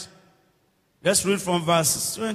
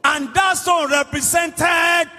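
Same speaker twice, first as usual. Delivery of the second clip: raised, emphatic prayer declaration; forceful preaching; 6 semitones higher than usual. Real, not acted. Preacher praying victory over giants for every listener, forceful declaration.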